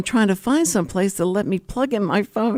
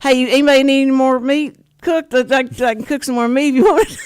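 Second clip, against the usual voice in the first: mock cook calling out; 6 semitones higher, 6 dB louder, faster than usual. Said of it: "the guy comes out around the back and goes".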